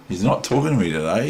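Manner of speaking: normal talking voice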